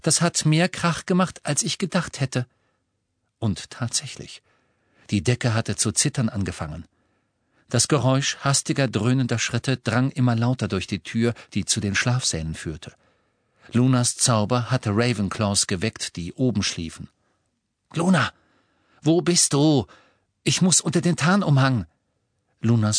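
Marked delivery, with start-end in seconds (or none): 0.0-2.5 higher, lilting character voice; high voice, mildly interested; Luna's mild surprise. A narrator's reading. 17.9-21.9 urgent call. A narrator's reading. Harry urgently seeks hidden Luna.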